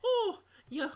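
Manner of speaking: high, hammed-up granny with a Scottish lilt; old Scottish woman. Old woman offering a lass cookies, hammed-up Scottish granny. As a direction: play a loud little old lady, Lucky Charms accent. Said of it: "it's an old woman".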